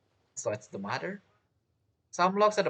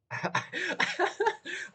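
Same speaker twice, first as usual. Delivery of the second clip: sudden high burst of laughter; a high, surprised laugh bursting out; real laugh. Real, not acted. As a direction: crack up at the scene. Laughing at a game scene.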